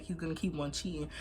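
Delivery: usual speaking voice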